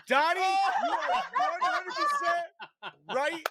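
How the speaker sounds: high, slow words squeezed out through laughter; cracking up while agreeing; laughing. Real, not acted. Laughing hard while telling Donnie he is right.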